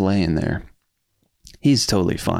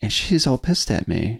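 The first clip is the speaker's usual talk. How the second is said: ordinary talk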